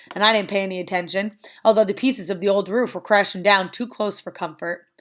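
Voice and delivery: usual speaking voice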